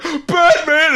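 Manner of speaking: high-pitched mock call; cop calling out. Imitating cop at the crime scene, mock calling. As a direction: call the name in imitated alarm. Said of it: "and the cop's like".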